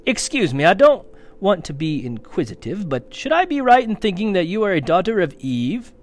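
a courteous, eager little faun; polite fawn. A narrator's reading.

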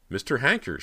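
high-pitched startled call; surprised cry. A narrator's reading. Cody recognizes the man in the doorway, surprised cry. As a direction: cry out the name in sudden recognition. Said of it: "she cried".

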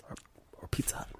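voiceless whisper. Acted. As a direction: whisper the reveal. Whispered dramatic reveal.

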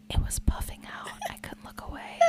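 hushed, guilty whisper; whispered confession. Real, not acted. Host admitting to cohost what she stared at, whispered. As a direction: whisper it like a shameful secret.

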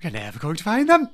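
high, put-on theatrical voice; Taylor moment. Joining in on Amanda's big moment, theatrical. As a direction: echo it as a hammed-up dramatic declaration. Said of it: "Very Taylor, yeah".

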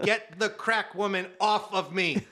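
loud, high exasperated demand; retold exasperated plea. Guard pinned under a woman begging his coworker, exasperated. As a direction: plead loudly for help, playing it up for laughs.

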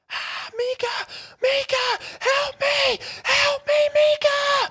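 shrieked very high and loud, half crying; terrified screaming. Retold or imitated. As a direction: scream for help in sheer panic. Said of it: "she starts screaming out of nowhere".

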